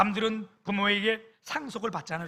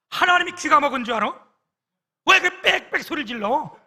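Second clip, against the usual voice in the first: higher, louder scornful telling-off; mock the pastor's sharp rebuke; scolding rebuke; 6 semitones higher, 11 dB louder than usual. Senior pastor scolding him publicly. (담임 목사의 비꼬는 호통)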